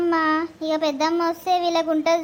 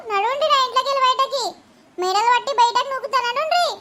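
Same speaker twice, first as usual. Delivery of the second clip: higher, fast, laughing shout of get out; furious get out; 5 semitones higher than usual. Acted. Sister-in-law throwing the mother and daughter out, with a rising, cackling yell. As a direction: chase them out of the house, rising to a high yell on నడువుండ్రి.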